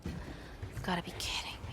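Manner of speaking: hushed disbelieving whisper. Acted. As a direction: breathe it under your breath. Muttered disbelief, unvoiced.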